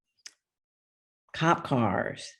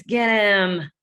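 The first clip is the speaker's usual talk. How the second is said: louder, higher call to chase; excited shout; 6 semitones higher, 6 dB louder than usual. Acted. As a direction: yell it to the others, get him. James chasing the villain.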